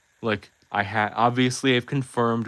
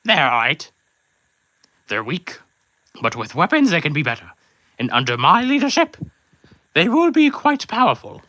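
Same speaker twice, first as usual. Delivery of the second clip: much higher, louder villain voice, swelling with pride; Sir Pentious; 6 semitones higher, 5 dB louder than usual. Acted. Sir Pentious bragging to fans about his egg henchmen, high and loud. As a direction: boast like a pompous cartoon snake villain. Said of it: "Sir Pentus".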